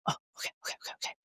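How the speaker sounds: whispered quick okay okay; hushed okays. Retold or imitated. Hyper friend asked to tone it down, whispered okays. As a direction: back off in a whisper.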